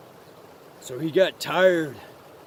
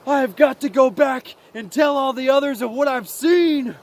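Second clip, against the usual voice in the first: high, loud, eager announcement; excited little mouse; 6 semitones higher, 4 dB louder than usual. Acted. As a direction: burst with news as a little mouse.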